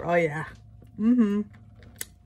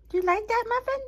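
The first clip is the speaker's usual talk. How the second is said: much higher sing-song voice for a pet; 14 semitones higher, 5 dB quieter, faster than usual. Real, not acted. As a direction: coo at the dog in a high voice. Talking to her dog.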